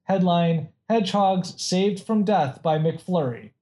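normal talking voice

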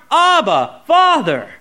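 preacher voicing a bold cry; heartfelt cry. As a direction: call out each word firmly, separately. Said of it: "He'll cry in your heart".

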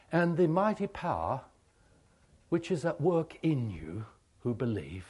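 unhurried, reverent benediction; solemn blessing. Acted. Gives closing blessing.

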